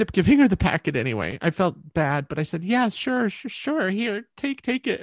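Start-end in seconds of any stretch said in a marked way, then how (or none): 2.6-5.0 high, over-willing mock politeness; forced agreeableness. Musician giving up the packet while crying inside, falsely willing. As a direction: hand it over too readily, pitched up. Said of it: "And I'm crying inside".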